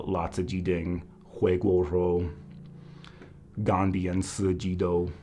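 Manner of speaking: "La zi ji ding," "Hui guo rou," "Gan bian si ji dou." as Mandarin dish names in a learner's careful accent; "Gan bian si ji dou." said slowly, syllable by syllable, with attempted Mandarin tones; foreigner speaking mandarin. Shown as a demonstration. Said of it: "most of the things I can say in Chinese are food".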